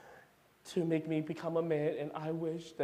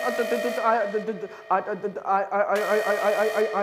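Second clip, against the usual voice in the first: stuttering in panic; panicked stutter; 3 semitones higher, 5 dB louder than usual. Acted. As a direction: trip over the words in fear.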